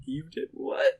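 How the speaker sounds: high, stunned "what?"; shocked disbelief. Retold or imitated. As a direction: jump up in pitch, stunned. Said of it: "I'd be like".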